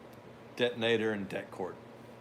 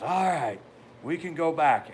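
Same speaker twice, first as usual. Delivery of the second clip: loud instructor call; 6 semitones higher, 7 dB louder than usual. Retold or imitated. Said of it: "and finally it says".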